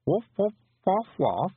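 tentative high woofing; imitated creature talk. A narrator's reading. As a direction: try out nonsense woofs in reply.

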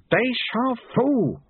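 high, drawn-out exclamation; slow triumphant call; triumphant cry. Acted. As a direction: announce the move slowly, pitched high. Triumphant cry as trap springs.

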